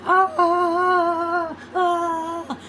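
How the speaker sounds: high strained mock screams; short mock cries of agony; imitating a scream. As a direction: mimic a man screaming in pain. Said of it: "he's screaming".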